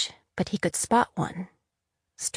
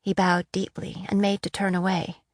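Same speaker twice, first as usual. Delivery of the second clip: plain reading voice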